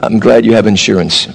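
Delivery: low, gentle reassurance after a fender bender; lightly voiced gentle driver; calm kind reply. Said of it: "you can be like".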